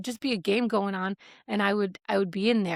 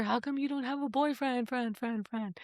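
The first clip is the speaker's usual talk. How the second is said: softer voice, trailing off like an echo; fading echo; 2 semitones higher, 7 dB quieter than usual. Retold or imitated. Question always in the atmosphere, fading echo. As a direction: imitate a nagging question echoing away.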